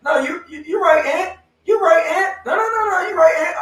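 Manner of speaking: very high, loud falsetto mimicry; mocking friend imitation. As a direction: squeal a fake concession over and over. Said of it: "he'd be like".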